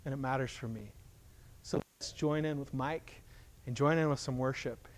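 ordinary talk

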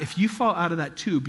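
normal talking voice

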